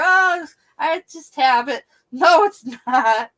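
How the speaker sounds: loud, high, drawn-out retold argument; imitated grandmother-granddaughter back-and-forth; mock bickering. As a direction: stretch it out loud, acting a family squabble. Acted bickering with grandmother over pocketbook.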